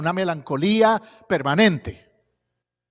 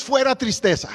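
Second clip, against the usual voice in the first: loud, higher rebuke of "fuera tristeza"; commanding rebuke; 6 semitones higher, 5 dB louder than usual. Real, not acted. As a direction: cast out "fuera tristeza" with force. Rebuking sadness in Jesus' name. (reprensión fuerte y aguda)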